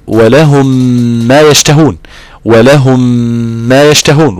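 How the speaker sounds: long hummed meem joining "ولهم" to "ما يشتهون"; nasal ghunna. Shown as a demonstration. Teacher reciting the verse to prove it, held nasal ghunna in "ولهم ما". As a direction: hold the m of "ولهم" through the nose. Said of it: "غنة من الخيشوم قولا واحدا".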